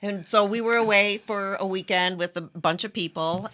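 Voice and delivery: normal talking voice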